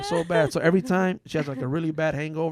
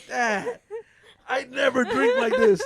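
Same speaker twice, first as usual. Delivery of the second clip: high, slow whiny protest, laughing; half-laughing, half-crying plea; mock pleading; 10 semitones higher, 7 dB louder, much slower than usual. Pleading with her angry dad, high and slow.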